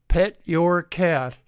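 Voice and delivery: normal talking voice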